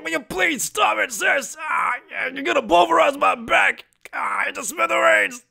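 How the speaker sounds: strained pleading. Acted. Ganju begging his sister to stop crushing him. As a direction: plead while being crushed.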